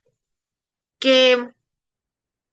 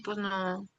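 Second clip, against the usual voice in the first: normal talking voice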